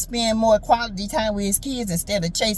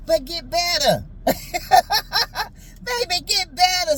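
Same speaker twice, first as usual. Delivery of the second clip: laughing squeal; 7 semitones higher than usual. Acted.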